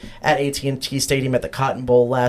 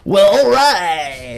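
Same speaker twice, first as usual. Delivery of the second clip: slow drawl; 3 semitones higher, 4 dB louder, much slower than usual. Acted. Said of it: "now the vibe's gone".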